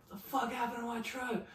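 high, shocked angry father; a dad yelling at his son about his wrecked truck. Retold or imitated. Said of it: "He's like".

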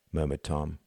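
plain reading voice

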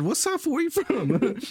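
high mocking taunt; mock challenge. Challenging the geezer at the post office, high mocking taunt. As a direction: fire it up high like a dare. Retold challenge to old man.

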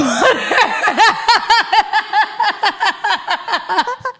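high burst of laughter; high giggle. Real, not acted. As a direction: giggle at yourself. Laughing at own story.

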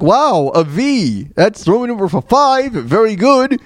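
fake-praise like a game show host; imitating Trebek. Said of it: "Trebek's like".

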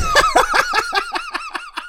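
high, wheezing laugh; real helpless laughing fit; cracking up. Real, not acted. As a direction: dissolve into laughter after the punchline. Cracks up at own bit.

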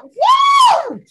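high, loud joyful whoop; joyful shout. Real, not acted. Cohost whooping at her friend's good news, high and loud. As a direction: let out a celebration whoop. Celebrating her appointed time.

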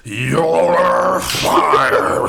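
drawn-out, high bellow; furious shout. Acted. Supervisor fires the worker on the spot, furious shout. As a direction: roar it, stretching each word.

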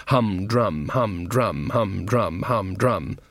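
low, flat rhythmic hum drum; monotone drone. A narrator's reading. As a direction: chant the boredom like a drumbeat. Rhythmic tedium chant.

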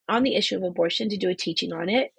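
ordinary talk, nothing marked

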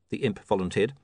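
usual speaking voice

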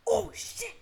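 high, loud imitation of shocked boys; squealed retold "oh shit"; mock startled yell. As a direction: imitate someone walking in on you. Voices boys walking in on her.

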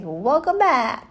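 high, loud drawn-out welcome; called-out greeting. Retold or imitated. Woman greets returning missionary at the airport, loud acted welcome. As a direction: sing out an acted welcome, slowly.

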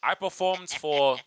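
slow, deliberate American accent attempt. Shown as a demonstration. Actor performs to prove himself, slow attempted American accent. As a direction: overwork each word in a bad American accent. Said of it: "He just can't do an American accent".

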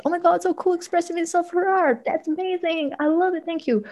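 gushing admiration, bright and high; gushing delight. Retold or imitated. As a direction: gush over it in a high voice. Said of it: "It's like".

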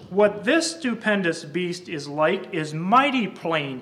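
slow, loud dramatic poem reading; poem recitation. Acted. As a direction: declaim the verses to the congregation. Recites the blind men poem.

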